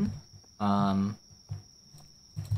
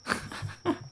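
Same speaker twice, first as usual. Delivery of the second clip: breathless laugh; 7 dB quieter than usual. Real, not acted. Laughs at the doctor line.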